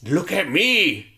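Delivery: hammed-up thug shouting at the woman; mock yelling. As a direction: bark it at her, building. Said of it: "is just like".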